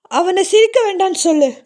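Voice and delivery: high, pleading shouted repeat; frantic shouting. Acted. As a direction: yell it at his son, begging. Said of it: "மறுபடியும் கத்த ஆரம்பித்தார்". (வெறித்தனமான கத்தல்)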